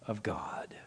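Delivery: slow, soft whisper of worship; hushed awe. Acted. Disciples in the boat worshipping Jesus, slow awed whisper. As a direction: let it fall to a whisper.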